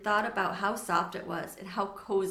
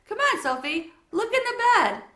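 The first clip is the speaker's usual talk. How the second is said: calling over; 12 semitones higher, 8 dB louder than usual. Acted. Mom calls Sophie over.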